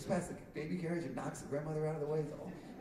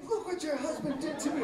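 high-pitched imitation of accusing mom, laughing; mock outraged accusation; 11 semitones higher, 3 dB louder than usual. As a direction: play the wronged mom with laughter in it.

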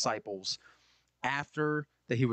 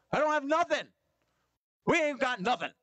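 frustrated fisherman; 10 semitones higher than usual. Retold or imitated. Fisherman answering the stranger, high and fed up. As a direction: drag it out, fed up.

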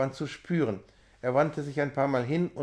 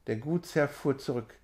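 plain reading voice

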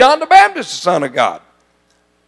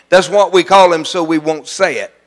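ordinary talk

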